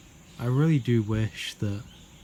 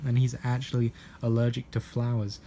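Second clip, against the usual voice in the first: normal talking voice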